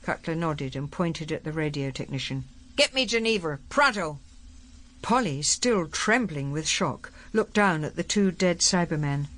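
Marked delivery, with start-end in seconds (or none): 2.8-4.2 higher-pitched, commanding general; brusque general. Acted. General ordering the radio technician to connect him, brusque and commanding. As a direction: give the order as a brusque military man.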